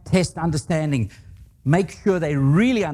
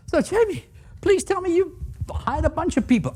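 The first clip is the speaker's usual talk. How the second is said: much higher, half-joking plea; mock pleading; 14 semitones higher than usual. Speaker pleading with marketing person Jamie, higher mock plea. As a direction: beg her in a raised, hopeful voice.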